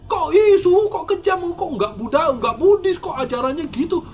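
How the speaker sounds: high, loud indignant objection, lightly voiced; a hint of a shocked critic protesting; mock outraged critic. Teacher voicing critics calling the teaching cruel, in mock outrage. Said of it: "Ceritanya begitu".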